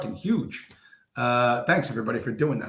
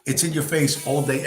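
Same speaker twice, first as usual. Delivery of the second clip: ordinary talk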